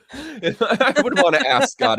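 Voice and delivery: high laughing joke. Real, not acted. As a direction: giggle it out. Laughing while joking.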